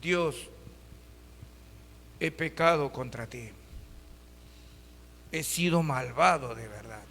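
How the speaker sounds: low, soft repentant plea with a touch of tears; a king confessing in prison, lightly voiced; tearful prayer. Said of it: "comienza a llorar y dice".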